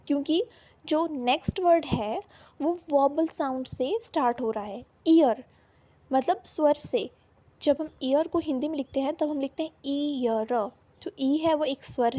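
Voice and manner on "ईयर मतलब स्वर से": normal talking voice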